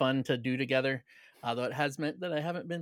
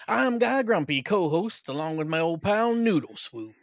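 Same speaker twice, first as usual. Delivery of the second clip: put-on folksy cowboy accent, pitched high; put-on cowboy accent, big and showy; Wild West accent; 5 semitones higher, 4 dB louder than usual. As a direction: open the show like a loud, hammy Western host. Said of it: "talking with that accent".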